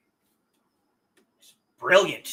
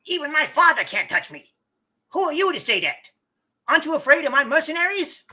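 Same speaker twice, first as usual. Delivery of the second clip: high, fast whiny weasel voice; 9 semitones higher, 4 dB louder, faster than usual. Acted. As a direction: sneer like a spoiled weasel. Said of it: "he's getting weasel voice".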